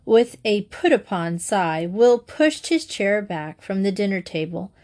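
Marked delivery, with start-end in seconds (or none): none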